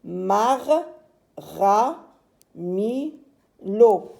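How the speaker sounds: "Mar, ra, mi, lo" one at a time; four isolated beats, "Mar, ra, mi, lo"; separate syllables. Shown as a demonstration. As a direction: read out "Mar, ra, mi, lo" with clear pauses. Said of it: "Nous avons ici quatre syllabes".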